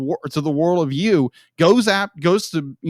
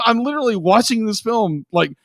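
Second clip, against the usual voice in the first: ordinary talk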